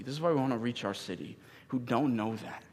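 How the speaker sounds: ordinary delivery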